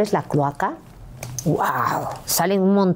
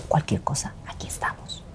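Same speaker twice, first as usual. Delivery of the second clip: hushed, whispered reassurance; secretive whisper; 3 semitones lower, 8 dB quieter than usual. Retold or imitated. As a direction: whisper it like a covert pact. (susurro cómplice, muy bajito)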